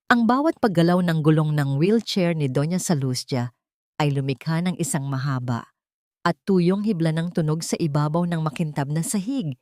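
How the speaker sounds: usual speaking voice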